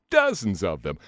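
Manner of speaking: usual speaking voice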